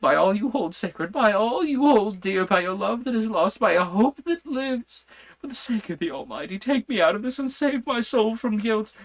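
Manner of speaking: high, loud frantic begging; a madman begging on his knees for release; desperate pleading. A narrator's reading. Madman on his knees begging the doctor to free him, frantic high pleas. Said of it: "poured forth a torrent of entreaty, with tears rolling".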